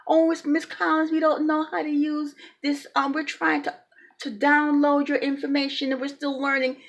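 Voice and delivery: sing-song imitation of bureaucratic excuses; mocking office staff. Mocks housing office staff's excuses.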